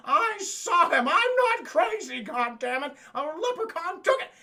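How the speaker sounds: hammed-up woman insisting she's sane; mock frantic insistence. Retold woman insisting to cop.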